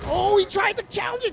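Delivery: high-pitched excited shout; excited play-by-play. Real, not acted. Commentator calling a failed challenge, high excited shout. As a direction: shout it out as the action happens.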